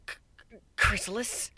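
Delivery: a sudden scared stammer; startled yelp. A narrator's reading. Kuno startled awake by the intruder. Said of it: "Kuno yelped".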